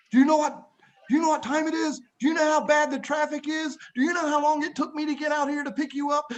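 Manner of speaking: high-pitched scolding rant; mock yelling. Uncle scolding his nephew at the airport, mock yelling. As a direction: rattle off the complaints like an annoyed uncle. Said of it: "he started yelling at me".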